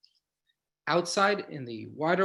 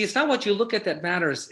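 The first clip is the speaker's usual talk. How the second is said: ordinary talk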